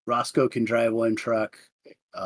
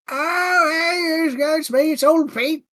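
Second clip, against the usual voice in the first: put-on much higher, cracking old-man voice; squeaky, warbling old fellow; old Pete voice; 13 semitones higher, slower than usual. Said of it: "I got old Pete".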